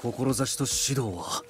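very slow, weighty proverb; slow solemn vow. Acted. Warrior reflecting on will after felling the tree, slow and solemn. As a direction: let each word land like a stone.

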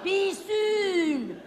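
loud, high and insistent; firm order. Acted. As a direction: hammer the order home. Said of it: "I said".

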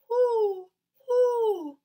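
very high, loud hooting owl. Shown as a demonstration. Teacher hooting like an owl for the rhyme. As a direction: hoot like an owl. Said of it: "You say, "Whoo, whoo," like the owl".

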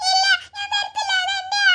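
put-on frightened small girl, squeaky and high; childlike wail of fright; high little girl voice. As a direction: play a scared little girl crying for her brother. Child refusing.

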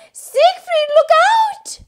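very high, loud warning scream; mother screaming at her son. Acted. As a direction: shriek the warning. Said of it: "Mama Von screams".

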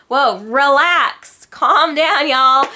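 high, loud, slow drawn-out scolding; mock calm down. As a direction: tell them to chill, teasing. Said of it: "And I'm like".

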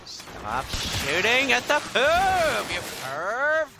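high, loud, slow yell; outraged yell. Real, not acted. Gamer yelling at an enemy shooting at them, high outraged yell. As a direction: bellow it, outraged.

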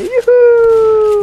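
long high-pitched youhou, stretched slowly; a teasing slow cheer way up high; playful drawn-out yell of glee; mock whoop. Imagining a boomer attack.

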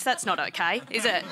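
ordinary talk, nothing marked

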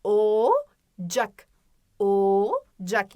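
"Ocak" with a long held o, pause, short "cak"; stretched initial O. Shown as a demonstration. Teacher stressing the first sound, long o in "Ocak". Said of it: "Yine başına çok fazla vurguluyorum".

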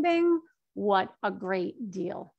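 ordinary delivery

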